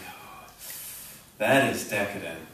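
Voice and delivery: low, soft, mock-reverent; quiet, low swoon over a treat; hushed awe. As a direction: imitate overwhelmed customers in a hushed voice. Customers gasping over pastries, acted.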